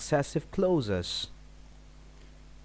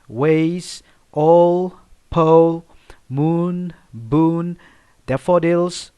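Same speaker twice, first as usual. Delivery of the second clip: each rhyming word called out louder, one by one; loud rhyme words; 2 semitones higher, 9 dB louder, much slower than usual. Shown as a demonstration. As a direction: hammer out each rhyming pair for the class. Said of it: "the last word is never".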